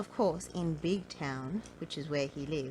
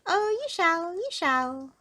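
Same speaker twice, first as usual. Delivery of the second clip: high, loud goblin glee; grinning goblin; 12 semitones higher, 7 dB louder than usual. A narrator's reading. Goblin answering angry Mister Uppity, grinning high voice. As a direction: promise it with a high-pitched grin. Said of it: "grinned the goblin".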